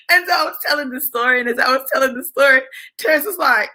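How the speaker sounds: plain reading voice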